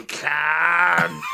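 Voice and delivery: mock greeting shout. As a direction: bellow it out, stretched. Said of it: "and I'll just go".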